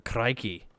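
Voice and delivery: "Crikey" with a Scottish rolled R. Shown as a demonstration. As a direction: roll the R in "Crikey" like a Scot. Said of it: "That's a very Scottish term".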